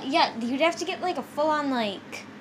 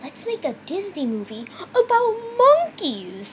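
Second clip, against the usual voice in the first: a bad British accent, higher; fake British accent; 6 semitones higher, 4 dB louder than usual. Co-host doing a botched British accent as [name]. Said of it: "That wasn't British".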